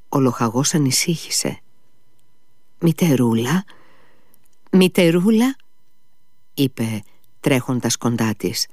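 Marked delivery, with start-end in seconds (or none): 2.8-5.6 anxious calling. Acted. Captain calls anxiously to wife.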